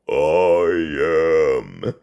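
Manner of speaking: deep demonic voice, very low; slow, very low villain growl; Diablo voice. Shown as a demonstration. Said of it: "My, my Diablo voice".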